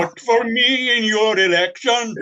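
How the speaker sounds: high, slow mock Jamaican sing; fake Jamaican accent. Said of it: "in a fake Jamaican accent".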